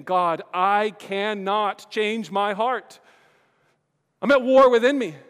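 slow, loud, rising plea; a desperate voiced prayer; pleading cry. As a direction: cry out to God, strained. Voicing a confession prayer.

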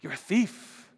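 a hint of a sneering creature; mild mock accusation; Gollum. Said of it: "Gollum says".

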